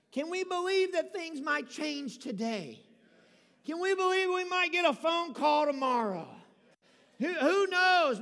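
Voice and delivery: rising sermon fervor, slowing at the peak; building preaching. Acted. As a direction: swell from hopeful question to exclaiming.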